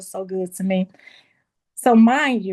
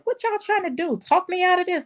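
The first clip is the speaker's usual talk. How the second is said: mock protest; 10 semitones higher than usual. Retold protest to dad and landlord.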